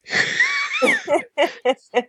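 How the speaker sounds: high shrieking burst of laughter. Real, not acted. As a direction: explode into a shrill laugh. Bursts out at name joke.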